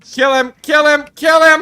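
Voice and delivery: screaming in panic; panicked shouting. Real, not acted. Panicked teammates in monster attack.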